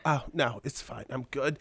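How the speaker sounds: imitating a frail-sounding mother, trailing off softly; weak, fading mom impression; mom's feeble voice. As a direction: play a mother who sounds like she's dying. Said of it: "Is your mom dying?".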